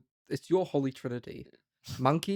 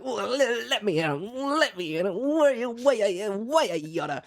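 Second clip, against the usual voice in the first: higher, mocking whiny insistence; mock pushy heroine; 9 semitones higher than usual. Friend mocks Bonnie insisting on going, higher and whiny. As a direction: parody her, high and whiny. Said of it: "And she's like".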